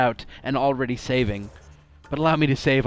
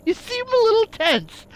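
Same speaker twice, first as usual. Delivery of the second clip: laughing tease; 3 dB louder than usual. Real, not acted. Teasing a tense character.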